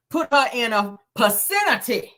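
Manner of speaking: "vicinity" said as puh-SEN-ity; mocking her mispronunciation. Quoting Wanda to the viewers, "vicinity" as puh-SEN-ity. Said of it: "She said, "Put her in a vicinity".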